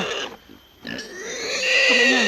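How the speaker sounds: loud and very slow, stretched out; slow mock threat. To a noisy friend, a loud, drawn-out warning. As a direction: drag every word out as a loud warning.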